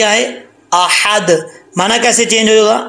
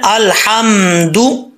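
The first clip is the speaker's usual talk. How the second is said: measured Quranic reading; slow recitation; much slower than usual. Shown as a demonstration. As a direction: chant it slowly with a rising end. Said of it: "कैसे पढ़ना है?".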